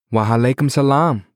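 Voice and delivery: a hint of the mother's warm formality; mother's greeting. A narrator's reading. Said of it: "his mother responded in the traditional".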